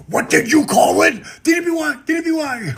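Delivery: high, loud shouted correction; hammed-up wrestler yelling at a fan; mock shout. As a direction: yell it like a wrestling promo. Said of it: "And I'm like".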